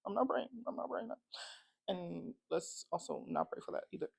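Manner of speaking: soft unvoiced whisper, half laughing. Real, not acted. Vlogger joking about her TV falling, whispered. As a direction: whisper it like a guilty secret.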